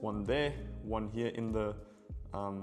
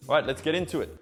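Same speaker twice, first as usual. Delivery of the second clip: loud, high energy kickoff; excited; 6 semitones higher, 8 dB louder, faster than usual. Real, not acted. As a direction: launch in with a burst. Hyped start of the tutorial.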